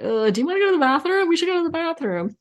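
high, fast whisked-away friend voice; urgent girlfriend. Retold or imitated. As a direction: hurry her off to the bathroom, pitched high. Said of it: "my girlfriend was like".

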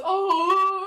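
loud, high mock cry; cartoon character impression. Teasing a friend's laugh, hammed-up cartoon cry. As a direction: belt out a cartoon exclamation. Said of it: "like the guy with Larry the Lobster".

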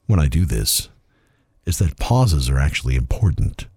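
smarmy slow voice. Pretend. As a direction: drag out every pause. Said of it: "the smarmy voice was creepy".

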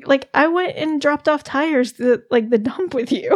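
usual speaking voice

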